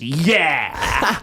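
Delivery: loud smug yeah; douchebag yeah. Retold or imitated. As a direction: drawl it like a smug rapper. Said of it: "sounds like an ultimate douchebag".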